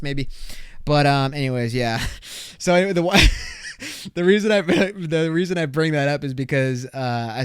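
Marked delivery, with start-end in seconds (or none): 3.3-4.1 soft voiceless laugh. Real, not acted. Podcaster after his [name] confession, soft laugh. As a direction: laugh quietly at yourself.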